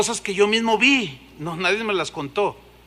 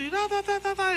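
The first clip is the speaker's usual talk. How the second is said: mock tongues babble; 11 semitones higher, 5 dB quieter than usual. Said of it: "en lenguas".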